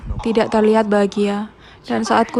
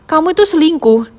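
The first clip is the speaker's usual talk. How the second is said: higher, louder accusation; wife confronting husband about cheating, raised voice; accusing outburst; 6 semitones higher, 4 dB louder than usual. Retold or imitated. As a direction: throw the cheating charge at him. Retold quarrel, Nicole accuses.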